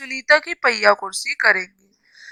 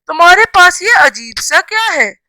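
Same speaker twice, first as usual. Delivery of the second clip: higher-pitched, mildly astonished question; surprised exclamation; 6 semitones higher than usual. Shown as a demonstration. Teacher reading an exclamation-mark example to children, mildly surprised. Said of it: "यहाँ पे विस्मय आदि बोधक चिन्ह होगा".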